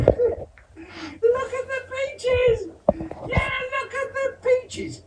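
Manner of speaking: put-on very high, slow, laughing kid's shout; child shouting gleefully. Older son walking around shouting about the peaches, very high. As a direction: crow it with glee. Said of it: "Joe is walking around saying".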